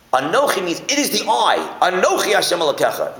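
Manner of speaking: high, loud, emphatic "Anochi" and "I"; stress on the I. Shown as a demonstration. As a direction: hit "I" hard, pitched well up. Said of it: "The emphasis on the I".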